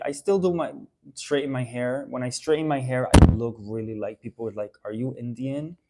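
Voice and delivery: normal talking voice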